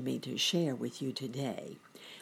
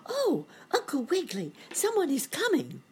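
put-on very high, urgent young-bunny voice; excited cry; 13 semitones higher, 4 dB louder than usual. A narrator's reading. Boy bunny spots someone coming, high excited cry. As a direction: cry out high to alert your uncle. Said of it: "Sammy cried".

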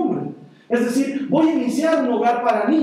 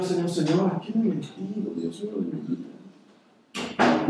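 despairing prayer; 3 semitones lower, 6 dB quieter, slower than usual. Retold or imitated. Retold anguished prayer to God.

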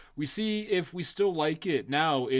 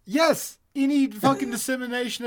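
high, emphatic yelp; mock outrage; 7 semitones higher than usual. Arguing with his cohost about the Irish, mock indignant yelp. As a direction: fire it back in mock offense.

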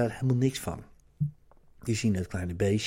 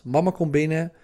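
ordinary talk, nothing marked

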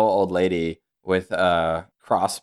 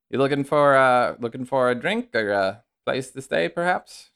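higher-pitched, folksy hostess voice; chatty innkeeper; 6 semitones higher than usual. Acted. Innkeeper welcoming a possible guest to her inn, chatty and friendly. Game master plays the innkeeper.

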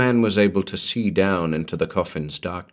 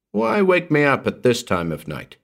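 higher-pitched, annoyed question; irritated demand; 6 semitones higher than usual. A narrator's reading. Walters roused by that fool, demanding irritably. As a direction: demand an explanation for being woken. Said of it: "he demanded".

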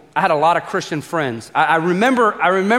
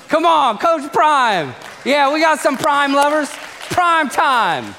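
drawn out and higher, hyping the crowd; hype call; 8 semitones higher, slower than usual. Acted. Preacher hyping up the crowd about Coach Prime, excited drawn-out call. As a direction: stretch it out like a stadium cheer.

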